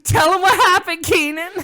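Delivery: high, loud eager demand; bursting to have the news told; excited prompt. Real, not acted. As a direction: shout to your co-host to spill it. Thrilled, prompting the co-host.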